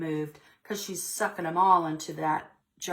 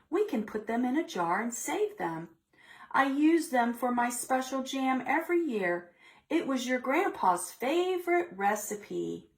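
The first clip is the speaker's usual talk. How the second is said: sing-song higher granny voice; higher gentle-granny calming voice; grandma voice; 8 semitones higher than usual. Acted. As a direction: soothe her like a fond grandmother. Grandma voiced in picture book.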